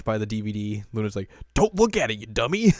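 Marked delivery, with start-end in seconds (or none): 1.5-2.7 high, loud exasperated telling-off; mock scolding. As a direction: scold a friend with comic alarm. Retold cat scolding her friend.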